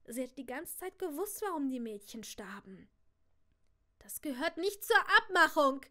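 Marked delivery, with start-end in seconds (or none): 4.0-5.8 loud furious scream. A narrator's reading. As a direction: scream it at the reaper. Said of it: "schrie meine Tante".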